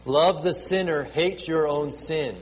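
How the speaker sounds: ordinary talk, nothing marked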